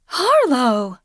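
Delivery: high startled cry of a name; gasp of alarm. A narrator's reading. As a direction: call her name in shock. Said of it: "she gasped".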